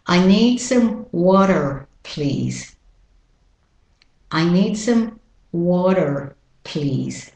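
American tapped D and er on "water" only. Shown as a demonstration. Teacher models the sentence, American pronunciation of water. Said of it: "All the words will be with my original accent".

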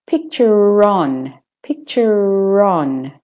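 "picture on" slowly joined by a drawn-out r; linking r. Shown as a demonstration. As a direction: link "picture on" with a sounded r. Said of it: "then you do say the er".

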